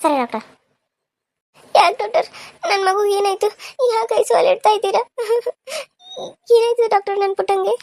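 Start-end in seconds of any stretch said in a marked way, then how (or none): 1.8-7.8 high, crying questions to the doctor; tearful pleading. Acted. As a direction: plead through tears for your baby. Mother hears baby goes to ICU. (ಅಳುತ್ತಾ ಆತಂಕದಿಂದ ಕೇಳುವುದು)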